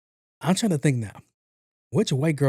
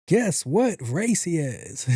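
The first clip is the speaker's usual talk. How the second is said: slow teasing question; 4 semitones higher, much slower than usual. Real, not acted.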